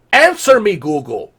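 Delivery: loud high command; demanding. Real, not acted. Shouting at Google to answer, loud demand. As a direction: bark the demand.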